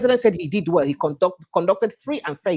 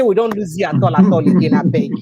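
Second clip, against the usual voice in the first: louder, pleading to keep the line; raised pleading voice; 7 dB louder than usual. Real, not acted. Host pleads with guest on bad line, raised voice. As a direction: push the words out so a faint caller hears.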